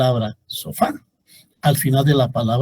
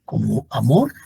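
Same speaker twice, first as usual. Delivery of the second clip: ordinary talk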